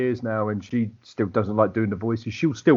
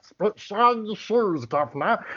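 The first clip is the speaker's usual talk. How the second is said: high, drawn-out creature voice with a Cockney twist; Cockney accent in a strangled high voice; Gollum; 10 semitones higher, slower than usual. Shown as a demonstration. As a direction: put on a slow Cockney creature voice. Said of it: "I do a Cockney Gollum".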